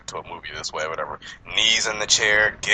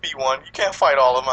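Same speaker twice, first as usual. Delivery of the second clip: higher-pitched worried pleading; nervous sister; 6 semitones higher than usual. Retold or imitated. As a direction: mimic a sister anxiously talking him down. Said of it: "my sister was looking all nervous".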